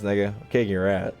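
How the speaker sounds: ordinary delivery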